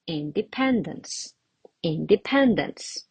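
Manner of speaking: independence with PEN stressed; teacher's natural model of indePENdence. Shown as a demonstration. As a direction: lean gently on the third syllable of independence. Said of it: "more natural?".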